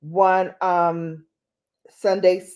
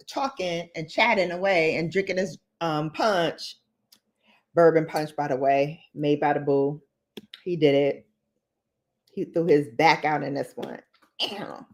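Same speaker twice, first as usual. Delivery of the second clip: ordinary talk, nothing marked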